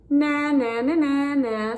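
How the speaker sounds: loud, high mocking na-na; taunting bird snort. Flugel bird taunting the squirrels, loud high mockery. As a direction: jeer it like a cheeky bird. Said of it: "snorted the flugel bird".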